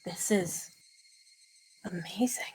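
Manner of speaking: hushed breathless wonder; awed whisper. Acted. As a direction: whisper in awe. Awed by view.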